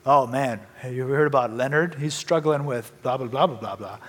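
mock gossip passed along under the breath; gossiping churchgoer.

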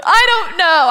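lightly hammed-up helpless wail; mock tearful. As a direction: imitate yourself starting to cry. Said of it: "I immediately start crying".

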